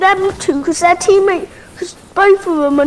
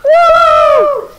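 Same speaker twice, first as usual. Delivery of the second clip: cheering whoop; 10 semitones higher, 5 dB louder than usual. Real, not acted. Cheering new skin.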